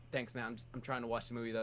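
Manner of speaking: low, soft, fast polite dismissal; quietly trying to end the chat; whispered theater reply; hushed brush-off. Retold or imitated.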